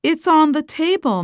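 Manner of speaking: calling out, high and quick; yelling across the room. Acted. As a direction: call it out to a kid in another room. Mom calls answer to son.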